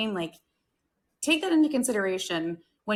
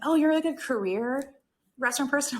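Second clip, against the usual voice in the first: higher-pitched imitation of a nosy questioner; lightly mocking, pitched up; mock incredulous question; 6 semitones higher than usual. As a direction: put on a surprised outsider's voice. Imitates people questioning her career.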